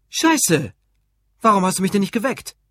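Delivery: loud, high angry yelling; furious. A narrator's reading. Husband blaming Ruth for letting him oversleep, furious. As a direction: explode in accusation. Furious he was not woken.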